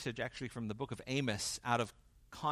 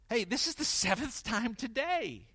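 a high, louder, put-upon complaint, lightly acted; mock exasperated; 9 semitones higher, 5 dB louder than usual. To the neighbor who keeps offending, mock exasperated. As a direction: huff it out like you're keeping score.

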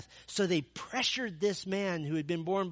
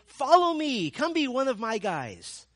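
eager invitation; 8 semitones higher, 7 dB louder than usual. Acted. Voicing Jesus' expected call.

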